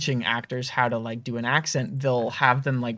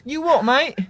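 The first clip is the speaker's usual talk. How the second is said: high, loud Australian-sounding mock British; over-the-top accent sliding into Australian; British turning Australian; 12 semitones higher, 5 dB louder than usual. Shown as a demonstration. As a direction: echo it in a squeaky Aussie-Brit accent. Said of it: "I feel like that's Australian at this point".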